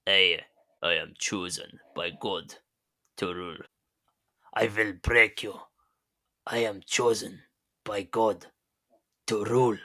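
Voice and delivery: halting, badly faked Russian accent; mock Russian accent. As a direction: act out a Russian with deliberate pauses. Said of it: "terrible Russian accent".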